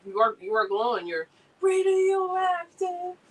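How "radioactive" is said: spooky drawn-out tease. Pretend. Teasing the streamer about the sunlight.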